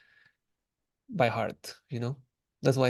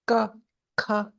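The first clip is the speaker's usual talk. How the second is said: ka said high and rising; 9 semitones higher than usual. Shown as a demonstration. Teacher demonstrating the high tone to students, high pitched.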